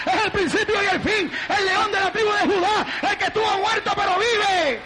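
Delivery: high, driving preacher shout; full-force preaching. Real, not acted. Preacher proclaiming who the Lord is, full-force preaching. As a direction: build into a shouted litany of praise.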